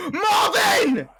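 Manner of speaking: shrieking his name in shock; high, loud scream of alarm; panicked shout. Real, not acted. Sees the Wither attack.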